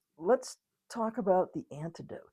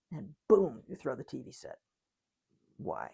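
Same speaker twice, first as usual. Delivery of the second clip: usual speaking voice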